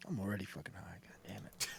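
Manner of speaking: breathy unvoiced aside; whisper. Real, not acted. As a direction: whisper it under your breath. Laughing aside, already high.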